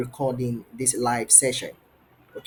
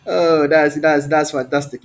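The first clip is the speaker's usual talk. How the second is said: enthusiastic praise; 6 dB louder than usual. Real, not acted. Praises student's love of cooking.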